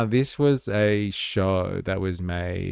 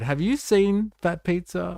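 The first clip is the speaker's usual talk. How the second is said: excited question to viewers; 10 semitones higher than usual. Real, not acted. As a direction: question pitched much higher, rising on you. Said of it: "I wonder if it made it across the ditch".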